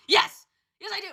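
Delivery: loud eager answer; eager yes. Pretend. Heroine answering hero, eager shout.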